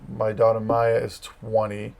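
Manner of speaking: ordinary delivery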